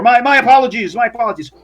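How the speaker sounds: apologetic servant. Acted. Fred apologizing with eyes on the floor, flustered. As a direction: plead for pardon, tripping over it. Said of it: "Fred's like".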